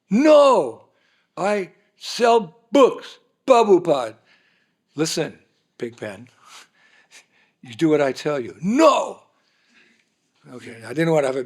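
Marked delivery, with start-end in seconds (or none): 0.1-4.2 much higher and louder, stubbornly dragged out; defiant shout. Retold or imitated. Retold quarrel with Pigpen. 4.9-8.6 loud, bossy order; stern command. Retold or imitated. Retold quarrel with Pigpen. 8.6-9.2 high, loud stubborn refusal; defiant shout. Retold or imitated. Retold quarrel with Pigpen.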